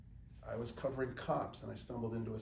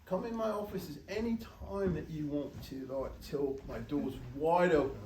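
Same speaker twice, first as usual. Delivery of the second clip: higher-pitched, hammed-up British accent; mock British editor, drawn out at the end; bad British accent; 5 semitones higher, 6 dB louder than usual. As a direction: do a terrible put-on British voice. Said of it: "I'm sorry to do this terrible British accent".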